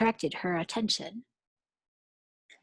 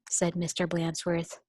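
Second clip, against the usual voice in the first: ordinary delivery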